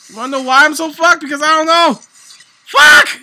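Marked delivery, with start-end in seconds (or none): 2.6-3.2 shrieks it way up high and loud; shrieked curse. Real, not acted. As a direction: streamer shrieking at the game in sudden panic, piercing and loud. Panic in a ranked game.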